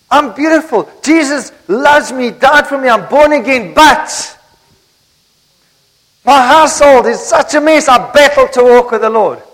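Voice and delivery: struggling believer. Acted. Churchgoer admitting to the preacher her home is a mess, loud and strained. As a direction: pour out the complaint, overwhelmed. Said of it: "Does that make sense to you?".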